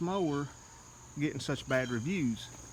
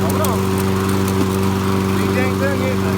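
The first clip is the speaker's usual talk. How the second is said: shouting over the mower; 6 semitones lower, 16 dB louder than usual. Real, not acted.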